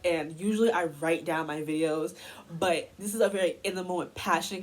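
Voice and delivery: ordinary talk, nothing marked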